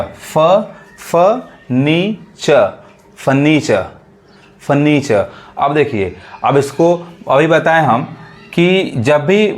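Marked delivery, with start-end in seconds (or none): 0.0-2.5 फ, नी, च as separate sounds without r; syllable-by-syllable demo. Shown as a demonstration. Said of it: "असल उच्चारण क्या है". 3.2-5.3 फर्नीचर with silent r's; taught pronunciation. Shown as a demonstration. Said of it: "आर को हम नहीं पढ़ते".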